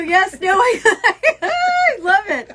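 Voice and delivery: high, loud and slow, laughing through it; gushing with delight. Real, not acted. Thanking friend for the sign, delighted and laughing.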